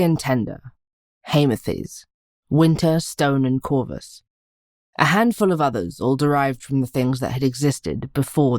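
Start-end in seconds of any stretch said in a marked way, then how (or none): none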